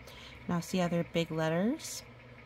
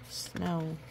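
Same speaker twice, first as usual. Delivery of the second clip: ordinary delivery, nothing marked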